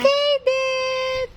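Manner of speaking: high, held-out name call; insistent high call. Real, not acted. Calling out to Kaden.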